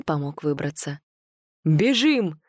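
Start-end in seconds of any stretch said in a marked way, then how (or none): 1.6-2.4 loud roar to run. A narrator's reading. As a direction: bellow the order. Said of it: "заревел Павел".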